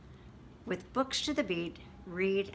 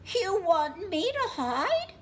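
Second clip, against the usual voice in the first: surprised bird; 12 semitones higher, 6 dB louder than usual. Acted. Bird voiced high.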